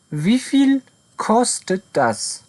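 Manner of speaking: each word laid out slowly; very slow model sentence. Shown as a demonstration. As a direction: read it out slowly for beginners. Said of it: "model sentence for learners".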